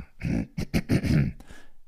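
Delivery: a deliberate clearing of the throat before changing voice. Acted. Wolf clears his throat before faking Red's voice. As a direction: ahem to reset the voice.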